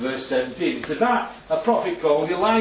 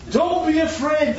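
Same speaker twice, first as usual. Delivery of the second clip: reassuring prophet; 10 semitones higher, 3 dB louder, slower than usual. Acted. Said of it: "the prophet answered".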